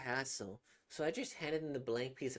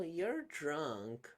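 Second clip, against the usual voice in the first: usual speaking voice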